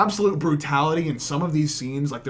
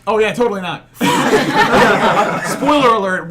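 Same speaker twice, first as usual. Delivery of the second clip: laughing; 5 semitones higher, 8 dB louder than usual. Real, not acted. Sarcastic joke, then laughing.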